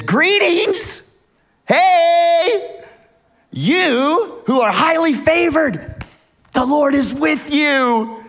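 angel bursting in on Mary's bedroom, thrilled to greet her; excited angel. Acted. Gabriel greets the teenage Mary with high excited joy. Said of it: "Gabriel is excited".

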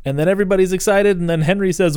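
ordinary delivery

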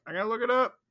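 high, playful sing-song; sing-song repeat. Pretend. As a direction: toss it off up high, teasing. Repeats it in a higher voice.

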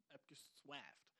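"Abgeschweift" drawn out slowly as "äb-ge-swäft"; mock-English pronunciation. Shown as a demonstration. Host toys with his wording, saying "Abgeschweift" as slow, soft mock English. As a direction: stretch "Abgeschweift" into fake English, softly.

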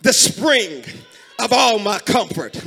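loud, drawn-out hymn recitation; fervent preaching. Real, not acted. Preacher reciting old church hymns to congregation, fervent. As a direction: cry the hymn lines out in bursts, long pauses between.

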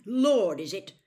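loud, scornful challenge; rough, defiant. A narrator's reading. Frank mocking the self-styled lord, rough and defiant. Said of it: "said Frank roughly".

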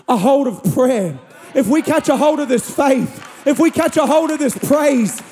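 high, driving sermon voice; impassioned preaching. Acted. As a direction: urge the congregation on, phrase after phrase. Preaches at full force.

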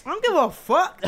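shouted, high and loud; loud outburst. Real, not acted. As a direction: blurt it out loudly. Joking outburst on recording.

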